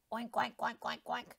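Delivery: oinks fading to a breathy end; pig oinking. Acted. Pig takes his turn crowing with fading oinks. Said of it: "crowed the pig".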